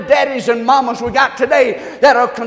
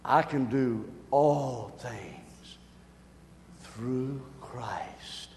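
much softer, slow, breathy reciting; hushed recitation; 12 semitones lower, 18 dB quieter, much slower than usual. Shown as a demonstration. Pastor leading the congregation, a hushed recitation. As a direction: say the verse low and slow with the people. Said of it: "say it with me".

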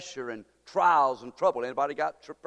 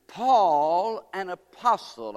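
loud, rising scripture read; preaching scripture; 3 semitones higher, 7 dB louder, much slower than usual. Acted. Preacher reading Corinthians to the congregation, loud proclaiming. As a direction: proclaim the opening words.

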